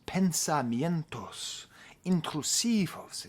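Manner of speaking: "Pensamientos intrusivos" very slow, each o ringing; savoring Spanish vowels. Shown as a demonstration. Vlogger praising Spanish vowel sounds, slow and savoring. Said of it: "So much vowel sounds".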